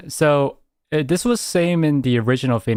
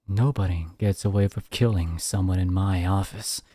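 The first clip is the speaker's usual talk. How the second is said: low cool imitation; 7 semitones lower, 6 dB quieter than usual. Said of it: "it's like".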